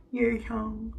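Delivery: put-on high, hesitant childlike reply; shy kid saying his name. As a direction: say it like a nervous eight-year-old on day one. Repeating name to confused classmates.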